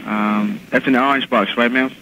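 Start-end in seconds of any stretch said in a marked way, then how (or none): none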